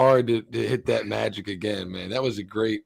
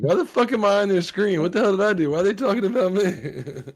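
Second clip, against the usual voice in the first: high-pitched, indignant bewilderment; mock bewildered outrage; 7 semitones higher than usual. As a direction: act baffled at being singled out, voice way up. Imagined reaction to being on screen.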